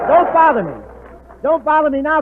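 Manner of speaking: shouting. Acted. Hope shouts at interrupting Professor.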